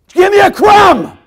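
full-force high screamed begging; drawn-out shouted plea; desperate scream. Acted. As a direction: shriek it slowly, every word at the top. Breaks in as the begging woman.

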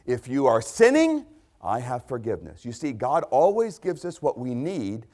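ordinary talk

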